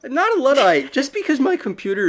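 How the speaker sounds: high, loud mock-offended protest; playfully affronted comeback; indignant denial. Protests being called a Luddite.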